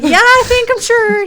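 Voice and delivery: very high, loud retort; mock-exasperated high yell; exasperated insistence. Wife in labor answering doubting husband, exasperated.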